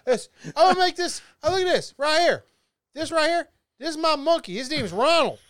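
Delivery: high put-on show-and-tell voice; comic monkey owner. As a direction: present it proudly in a high character voice. Plays proud owner of monkey hybrid.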